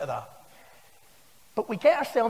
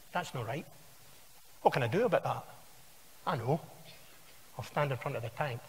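quiet inner thought; 7 semitones lower, 10 dB quieter than usual. Retold or imitated. Tank man thinking to himself.

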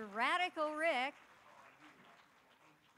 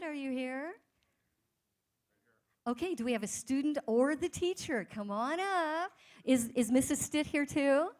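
loud, higher call to the whole hall; big, raised emcee shout-out; calling out to a crowd; 4 semitones higher, 10 dB louder than usual. Real, not acted. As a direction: summon someone up from the audience. Host summoning winner from audience.

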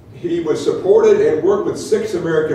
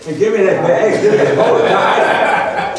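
loud joking call, higher, laughing; playful shout; 4 semitones higher, 6 dB louder than usual. Real, not acted. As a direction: tease him loudly over the bag. Joking struggle over the bag.

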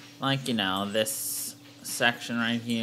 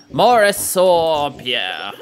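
exasperated; 9 dB louder, slower than usual. Real, not acted. Mock outrage at shopkeeps.

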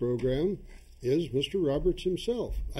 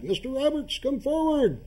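higher, eerie ritual summons; eerie summoning; 11 semitones higher than usual. Acted. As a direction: summon a spirit, high and drawn. Chairman summoning the ghost again.

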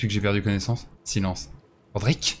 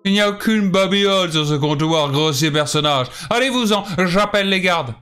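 higher, louder offended woman; indignant high widow; 6 semitones higher, 4 dB louder than usual. Acted. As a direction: throw him out, high and loud. Widow orders him out.

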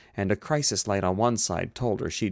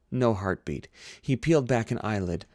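ordinary delivery

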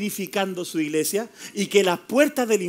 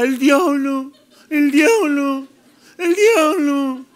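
a slightly higher, mocking whine on each diablo; mocking obsessed believer; 6 semitones higher, 4 dB louder, much slower than usual. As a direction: parody someone who can't stop saying diablo, slowing on the last one. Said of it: "Y usted está todo el día". (burla de alguien obsesionado con el diablo)

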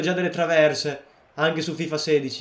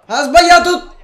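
shouted, much higher and louder; frustrated shout; 13 semitones higher, 14 dB louder than usual. Real, not acted. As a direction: yell the miss in disbelief. Shouts at missed shot. (grido frustrato)

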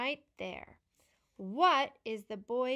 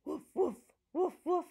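dog woof; 6 semitones higher, faster than usual. Shown as a demonstration. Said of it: "What sound does a dog make?".

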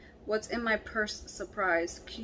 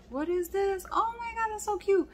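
much higher, eager gush; excited discovery; 11 semitones higher than usual. Real, not acted. Finding the candy cone dip, high and thrilled. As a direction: lift way up in delight.

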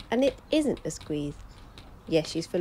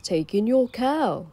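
put-on wise old sage; 3 semitones higher, 6 dB louder, slower than usual. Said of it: "said the wise old man".

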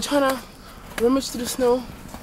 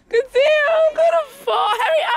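screaming while falling; 4 dB louder than usual. Real, not acted. Losing balance in deep snow.

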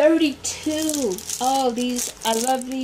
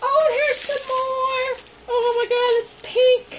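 thrilled squeal; 13 semitones higher, 5 dB louder than usual. Real, not acted. Finding pink gifts, thrilled.

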